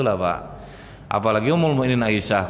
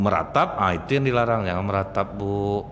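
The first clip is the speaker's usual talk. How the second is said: ordinary delivery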